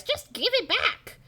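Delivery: high, slow cartoon professor demand; Professor Shock. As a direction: draw out the demand, pitch way up. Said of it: "Professor Shock replies".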